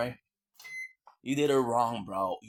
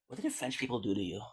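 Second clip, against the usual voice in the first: ordinary delivery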